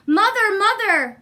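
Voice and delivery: loud, high excited calling. Acted. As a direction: call to mother across the garden. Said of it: "Jack called".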